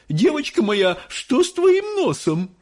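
very high-pitched alarmed mother; high squealing panic; shrieking. A narrator's reading. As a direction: shriek in horror at your child. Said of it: "вдруг завизжала миссис Боригард".

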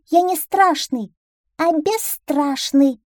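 very high, slow, emphatic duckling; defiant little duckling. Acted. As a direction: protest proudly in a high little voice. Duckling protests at fox's teasing. (задиристый утёнок, очень высоким голосом)

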